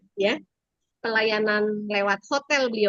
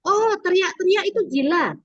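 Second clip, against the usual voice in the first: higher imitated little-kid voice; child's realisation; 8 semitones higher than usual. Boy answering his teacher, high childlike realisation. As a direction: voice a small boy working it out. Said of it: "Akhirnya dia bilang".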